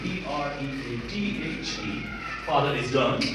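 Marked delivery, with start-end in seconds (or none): 0.0-2.0 slow spelling. Shown as a demonstration.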